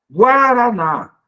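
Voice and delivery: "Guaraná" loud and slow, GUA held as one syllable; drawn-out syllables. Shown as a demonstration. Teacher presenting a word to split, syllables drawn out. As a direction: stretch out "Guaraná", high and loud, keeping UA together. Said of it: "Guaraná é na mesma sílaba".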